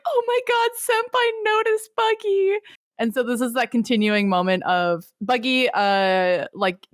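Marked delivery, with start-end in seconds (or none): 0.1-2.6 high-pitched mock fangirl squeal; awestruck fans. Everyone reacts to Whitebeard noticing Buggy, in high mock awe. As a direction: gush like a hammed-up adoring crowd. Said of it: "everyone's like".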